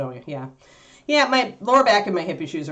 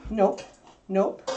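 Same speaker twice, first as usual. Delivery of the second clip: ordinary talk, nothing marked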